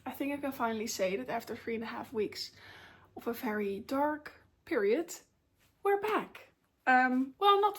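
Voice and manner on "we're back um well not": higher, proud announcement; triumphant. Real, not acted. Telling viewers she's recovering, higher and triumphant. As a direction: announce the comeback with pride. Triumphant return after dark weeks.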